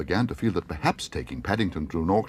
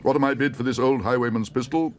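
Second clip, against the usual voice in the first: high, louder auctioneer pitch; auctioneer calling; 5 semitones higher, 4 dB louder than usual. Acted. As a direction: call it out to the room. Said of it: "called the auctioneer".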